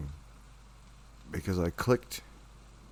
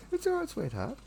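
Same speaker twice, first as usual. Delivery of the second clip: cooing pet talk. Real, not acted. Coos to cat.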